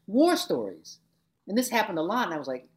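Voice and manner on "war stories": high, louder mock of rambling instructors. Teacher mocking lecturers who only told war stories, higher and louder. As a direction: imitate droning teachers telling war stories, hammed up. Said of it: "They talked".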